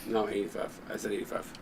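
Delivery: fading, breaking-up voice; words dropping out, barely voiced; voice cutting out. Real, not acted. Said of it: "my voice is petering right now".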